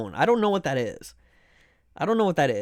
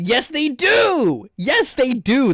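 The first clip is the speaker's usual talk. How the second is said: emphatic insistence; 5 semitones higher, 4 dB louder, much slower than usual. Real, not acted. Arguing with chat about apples.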